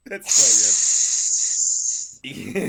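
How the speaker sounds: usual speaking voice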